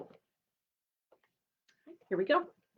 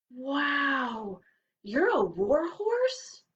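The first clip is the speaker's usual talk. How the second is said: amazed; 5 semitones higher, much slower than usual. Acted. Rabbit amazed.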